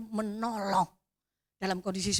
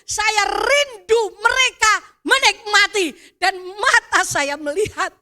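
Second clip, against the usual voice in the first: louder, higher fervent sermon voice; preaching at full force; 9 semitones higher, 12 dB louder than usual. Acted. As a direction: proclaim it to the congregation.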